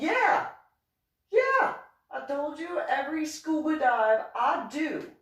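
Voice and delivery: high, emphatic mock retort; acted indignant outburst; exasperated insistence. Retold exasperated self-justification.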